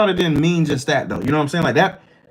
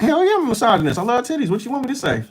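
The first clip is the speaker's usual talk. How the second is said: higher-pitched, mock-indignant retort; defiant comeback; 7 semitones higher, faster than usual. Answering people who call him misogynist, defiant. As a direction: throw it back with shameless defiance.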